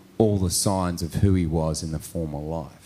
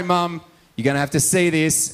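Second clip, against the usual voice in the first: mock warning; 9 semitones higher, 5 dB louder than usual. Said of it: "I'm like".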